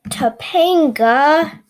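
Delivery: slow, loud drawn-out call of the name. Acted. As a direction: call her name across the room. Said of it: "Callie said".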